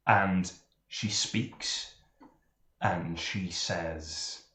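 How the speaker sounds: low, slow ominous narration; slow buildup. A narrator's reading. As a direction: draw it out slowly before she speaks.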